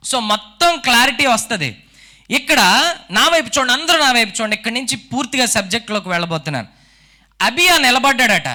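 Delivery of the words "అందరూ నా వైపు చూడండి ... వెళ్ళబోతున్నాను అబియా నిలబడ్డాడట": ordinary delivery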